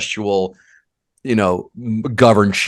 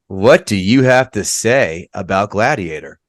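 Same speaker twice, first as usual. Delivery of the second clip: normal talking voice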